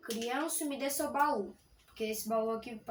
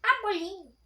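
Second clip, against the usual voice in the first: excited; 8 semitones higher, 10 dB louder than usual. Real, not acted. Excited at finding loot.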